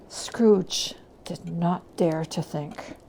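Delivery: ordinary delivery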